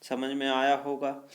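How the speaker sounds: ordinary talk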